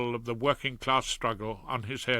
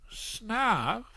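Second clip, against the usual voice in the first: higher, rising unsure echo of snuff; doubtful; 10 semitones higher than usual. A narrator's reading. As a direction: question the word snuff warily. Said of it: "Claude was doubtful".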